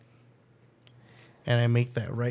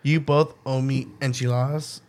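ordinary talk

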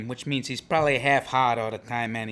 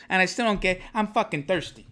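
normal talking voice

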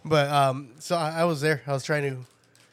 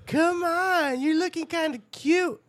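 high sultry come-on voice; high teasing hooker voice; 13 semitones higher, 3 dB louder, slower than usual. Retold or imitated. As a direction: play the hooker calling out to him, pitched way up. Hooker propositioning, retold.

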